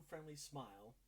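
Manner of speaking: ordinary talk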